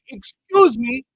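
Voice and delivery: high, loud indignant imitation; mock offended. Imitating a lady offended for a coworker, indignant. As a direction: take exaggerated offence on someone's behalf. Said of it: "trying to get all offended".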